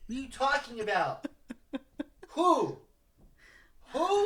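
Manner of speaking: very high squawking confusion, laughing; teasing imitation of a drunk friend, high and laughing; mock drunk yell. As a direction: screech it like a clueless drunk. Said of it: "shouting right now".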